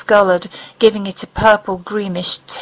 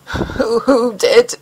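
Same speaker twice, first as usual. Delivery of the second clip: hoarse whisper; 3 semitones higher, much slower than usual. Acted. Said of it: "he asked in a hoarse whisper".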